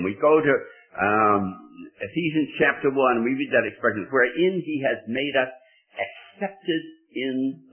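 high, loud pulpit delivery; fervent revival preaching; preaching at full force. Acted. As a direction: lift the congregation with rising fervour. Sermon climbs to full force.

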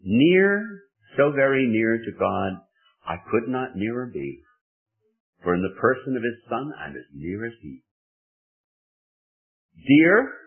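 high, loud hymn recitation; preached hymn words, near to God; recited hymn verse. Acted. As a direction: declaim the hymn lines with rapture. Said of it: "when we say or sing".

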